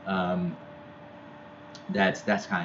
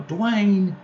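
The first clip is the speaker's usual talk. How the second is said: much higher, loud call of a name; mother yelling; 13 semitones higher, 5 dB louder than usual. Retold or imitated. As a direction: call your son across the house. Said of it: "would yell, you know".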